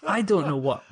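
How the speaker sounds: normal talking voice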